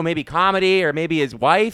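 ordinary talk

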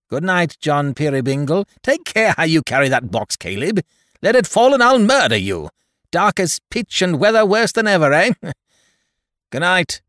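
loud, high threatening farewell; bully Caleb with a laughing threat; Tackleton, menacing and jeering. Acted.

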